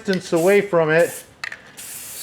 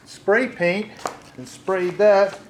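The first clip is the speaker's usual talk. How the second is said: ordinary delivery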